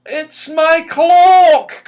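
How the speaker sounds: high, loud and slow with delight; excited cry. A narrator's reading. Silky cries out excitedly to her friends, high and loud. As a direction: cry out in joy as the clock arrives. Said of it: "cried Silky excitedly".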